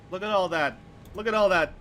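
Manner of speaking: high, loud exclamation, falling at the end; loud exclaiming. Real, not acted. Streamer pointing out the housing in the background, loud exclaiming.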